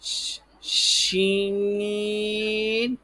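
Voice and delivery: شین opened with a long sh, vowel held high; sh first, then sustain شین on one note; long sh sound. Shown as a demonstration. Teacher models the sound for pupils, drawn-out شین. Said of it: "اس طرح کی آواز نکالیں".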